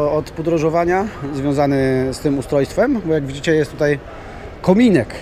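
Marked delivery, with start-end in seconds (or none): none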